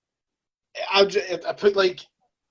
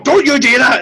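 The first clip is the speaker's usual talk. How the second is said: loud, higher parental scolding; telling-off dad; 4 semitones higher, 8 dB louder than usual. Retold or imitated. Dad figure giving someone trouble, loud telling-off. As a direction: scold like a parent laying down the law. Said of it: "I have total dad tone".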